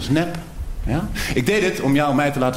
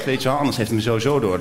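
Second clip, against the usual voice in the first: ordinary talk, nothing marked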